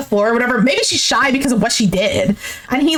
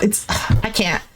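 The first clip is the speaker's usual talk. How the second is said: usual speaking voice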